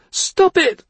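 loud high shriek. Acted. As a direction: shriek it covering ears. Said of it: "she shrieked".

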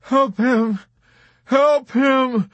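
high, loud, distraught cry; sobbing plea. A narrator's reading. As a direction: beg desperately, close to hysteria. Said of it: "Dobbs was sobbing".

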